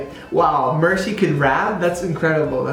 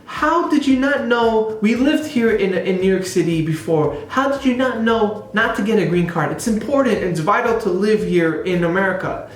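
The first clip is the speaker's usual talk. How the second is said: high, indignant acted-out scolding; frustrated complaint; 5 semitones higher than usual. Daughter confronting her parents over green card, frustrated. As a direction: imitate a daughter berating her parents, pitched up. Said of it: "she was having frustrations".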